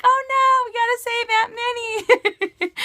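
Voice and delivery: very high squealing child imitation; mock panicked kids. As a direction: wail it in a hammed-up little-kid squeak. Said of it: "they're like".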